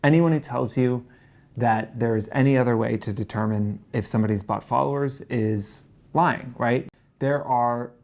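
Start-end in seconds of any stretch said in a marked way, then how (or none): none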